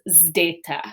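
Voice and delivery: "zdeta" begins with a blended zd, said slowly; Greek zeta as zdeta. Shown as a demonstration. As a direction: say "zdeta" with the z and d run together at the start. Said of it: "it's that zd sound".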